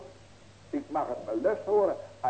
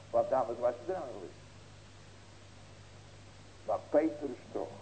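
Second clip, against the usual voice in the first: ordinary talk, nothing marked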